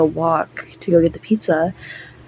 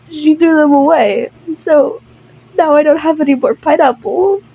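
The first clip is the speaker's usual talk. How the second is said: high, whiny mock tearfulness; hammed-up sad complaint in a raised voice; fake cry; 7 semitones higher than usual. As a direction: pout through a put-on sob. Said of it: "his fake cry and, like, fake sniffles".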